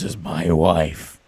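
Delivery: deep put-on podcast voice; low register. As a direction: drop the voice low. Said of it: "talk at this register".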